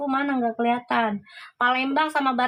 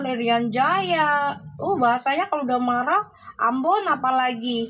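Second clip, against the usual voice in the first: ordinary talk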